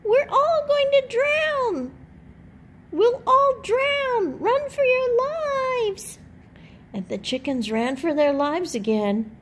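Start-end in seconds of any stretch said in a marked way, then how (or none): 0.0-6.2 much higher, louder, slow squeaky chick wail; squeaky chick voice shouting run; panicky peep. A narrator's reading. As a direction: wail it like a tiny chick fearing a flood. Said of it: "she peeped".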